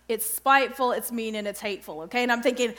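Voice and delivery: ordinary talk, nothing marked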